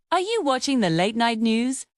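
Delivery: normal talking voice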